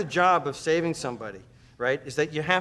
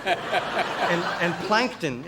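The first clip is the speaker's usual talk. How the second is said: usual speaking voice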